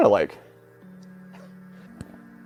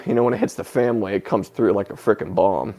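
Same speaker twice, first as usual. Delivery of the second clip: ordinary talk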